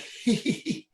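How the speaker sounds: breathy high throat chuckle; mock concerned laugh. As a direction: imitate a worried little laugh. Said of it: "and they're going".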